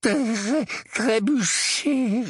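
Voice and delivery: high, stuttering, very slow shudder; trembling. A narrator's reading. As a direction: stutter it out while shaking. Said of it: "répondit Dudley en tremblant". (bégaiement tremblant)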